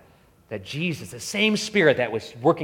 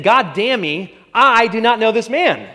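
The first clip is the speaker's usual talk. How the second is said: louder, higher desperate oath; cursing denial; 8 semitones higher, 6 dB louder than usual. Retold or imitated. Peter cursing himself to deny Jesus, raised and desperate. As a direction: swear it off. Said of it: "Basically saying".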